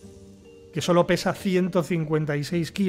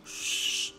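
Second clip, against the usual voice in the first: librarian shushing; 6 dB quieter than usual. Acted. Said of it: "tiene voz de bibliotecaria".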